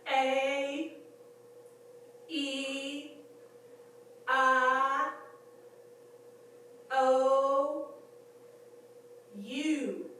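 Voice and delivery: vowels called out crisply, one at a time, pitched high; A, E, I, O, U sustained and lifted at the end; diction practice. Shown as a demonstration. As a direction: recite A, E, I, O, U as separate held notes of a drill. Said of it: "read the dictionary out loud in front of the mirror".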